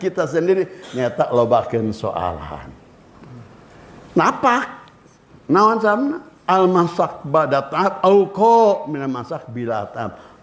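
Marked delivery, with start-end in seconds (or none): none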